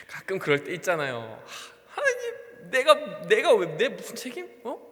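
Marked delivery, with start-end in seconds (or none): 1.5-4.9 half-crying, higher protest; hammed-up tearful "why me"; mock whimpering complaint. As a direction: whine at God like a tired pastor. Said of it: "막 이러면서".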